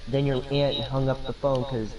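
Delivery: usual speaking voice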